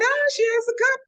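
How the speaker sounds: high, loud excited quote. Retold or imitated. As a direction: squeal it out. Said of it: "I said".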